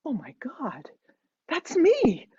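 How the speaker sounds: hushed gasp jumping to a high cry; alarmed realization. A narrator's reading. Narrator's alarmed inner reaction, acted.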